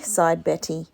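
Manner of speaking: ordinary delivery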